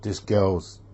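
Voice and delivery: ordinary talk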